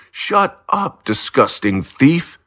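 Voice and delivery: high yell, easing off on the insult; shout. A narrator's reading. Paul yells at the thief on the ground, high shout. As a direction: keep yelling at him. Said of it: "he shouts".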